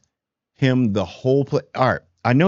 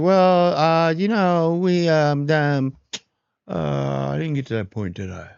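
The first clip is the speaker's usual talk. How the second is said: starts high and stalling, then fades down to a mumble; hedging nervously, trailing off; sheepish evasive woman; 5 semitones higher, slower than usual. Retold or imitated. Caught woman hedging to Adar, high then fading. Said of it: "She's like".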